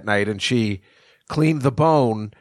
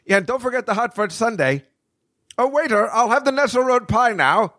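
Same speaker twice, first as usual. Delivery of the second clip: high, chirpy voice calling for more food; higher-pitched mock customer calling out orders; mock diner ordering; 11 semitones higher than usual. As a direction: call the waiter like a greedy glutton. Imagined himself ordering dessert.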